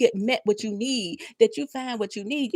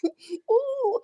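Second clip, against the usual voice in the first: teary laugh; 12 semitones higher than usual. Real, not acted. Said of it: "But I might cry".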